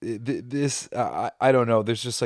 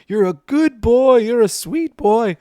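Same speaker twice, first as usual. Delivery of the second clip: much higher, louder, slow cooing; the doctor cooing praise at the monster, much higher and slow; soothing the monster; 8 dB louder than usual. Retold or imitated. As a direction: coo at him like a pet dog. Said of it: "this diatribe that he does".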